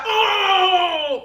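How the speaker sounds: long, high "oh" in imitation; mock howl. Woman mimicking man's tantrum at the wall, high mock howl. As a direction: wail it out, held and sliding down. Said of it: "he be like".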